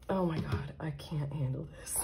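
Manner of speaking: very soft aside to yourself; overwhelmed, barely voiced; hushed exasperation. Real, not acted. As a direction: murmur it under your breath. Aside about crooked cards.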